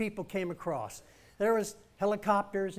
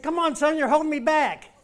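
high, loud, excited push to go faster; excited urging; 9 semitones higher, 10 dB louder than usual. Retold or imitated. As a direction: cheer him on, laughing. Dad urging son at finish.

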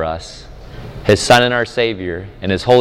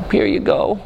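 higher, small tearful voice; imitating a teary toddler; 9 semitones higher than usual. Daughter tearfully returning the gift, lightly voiced tiny sob. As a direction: hand it back, small and sad. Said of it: "she starts to cry and she says".

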